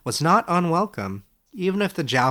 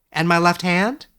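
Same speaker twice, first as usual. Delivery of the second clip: Edric's voice; 6 semitones higher, 6 dB louder than usual. Acted. Young scholar asks his old teacher, amazed and higher. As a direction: ask it wide-eyed, a step higher.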